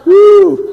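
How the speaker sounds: shrill spooky whoop; loud high whoop. Acted. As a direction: hoot like a ghost. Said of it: "That was a pretty cool effect".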